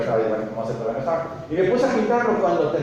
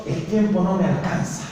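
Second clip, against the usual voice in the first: quoted complaint; 2 semitones higher than usual. Retold or imitated. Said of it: "cuando decimos".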